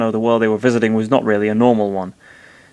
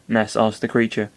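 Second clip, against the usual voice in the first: usual speaking voice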